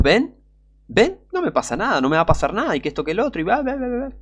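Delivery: high-pitched mocking imitation of a boaster; imitated smug taunting; mock arrogance. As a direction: act out cocky boasting, tossing off the blahs. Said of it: "Una soberbia, pero más alta".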